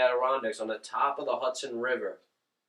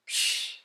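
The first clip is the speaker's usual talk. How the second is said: a short scoffing hiss of air; dismissive psh; 6 dB quieter than usual. Retold or imitated. Line-cutter brushing off a complaint, dismissive psh. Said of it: "He goes".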